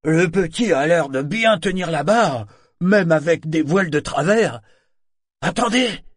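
high, loud, astonished call; disbelieving shout. Acted. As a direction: cry out as if you can't believe your eyes. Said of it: "Au ton de sa voix, Sorkor n'en croyait pas ses yeux".

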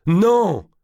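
repeated cry. A narrator's reading. Prince repeats his denial to the court, a loud high cry. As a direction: hurl the refusal out again. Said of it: "Il répéta".

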